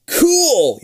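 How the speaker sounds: high loud excited squeal; eager cool. Acted. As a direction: gush it out with excitement. Eager reply to club invite.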